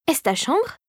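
mildly surprised question. A narrator's reading. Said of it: "demanda surpris Drosselmeyer". (légère surprise, voix plus aiguë)

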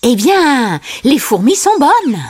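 high-pitched character voice; Timon. A narrator's reading. Timon suggests ants to Simba, high character voice. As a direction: offer it in a high cartoon sidekick voice. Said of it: "dit Timon".

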